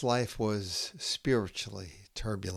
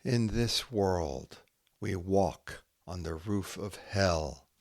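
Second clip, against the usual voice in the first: slow haiku reading with long pauses; poem reading; 7 semitones higher, slower than usual. Acted. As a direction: let each image hang in the silence.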